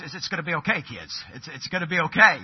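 a mild stammer in a brave dad's voice; stuttering reassurance. Retold or imitated. Dad stammering to his kids in the storm, mildly shaken. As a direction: stumble over the words while trying to sound calm. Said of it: "Dad's starting to have a little stutter in his voice".

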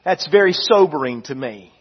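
usual speaking voice

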